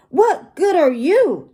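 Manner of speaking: high-pitched grumpy parrot mimicry; glowering parrot echo. A narrator's reading. Parrot mimicking the grumpy pirate's question, high glowering echo. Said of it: "and the parrot glowers too".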